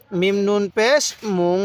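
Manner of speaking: slow spelling, মুং held as a nasal hum; teacher's prolonged nasal মুং; ghunnah demo. Shown as a demonstration. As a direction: let মুং ring through the nose. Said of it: "নাকের বাসি দ্বারা গুন্নাহ করুন".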